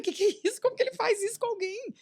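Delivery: high, outraged retelling of her outburst; shocked mother-in-law rant; indignant outburst. Retold or imitated. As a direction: fire it off, scandalized.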